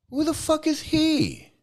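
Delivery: high-pitched, drawn-out indignant question. Real, not acted. Ranting about a self-styled holy man, indignant. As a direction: demand it slowly, pitch way up.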